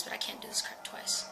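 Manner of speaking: hushed whisper to the viewers; scared whispered apology. Real, not acted. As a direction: breathe it out under your breath.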